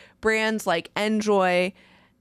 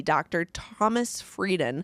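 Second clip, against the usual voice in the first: ordinary delivery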